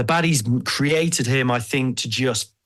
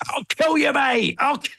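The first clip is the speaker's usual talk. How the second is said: much higher, mock-raging yell; mad threat. A-Bomb going mad at the Hulk, mock-furious high yell. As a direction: act out losing it, pitched way up. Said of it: "he goes mad at the Hulk".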